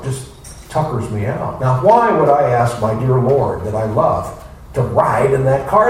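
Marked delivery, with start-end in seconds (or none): none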